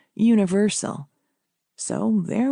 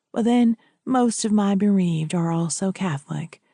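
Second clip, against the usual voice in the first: lower-pitched, measured priest; 4 semitones lower than usual. Acted. Priest arranging burial with a widow, low and measured. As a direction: speak as a priest explaining arrangements.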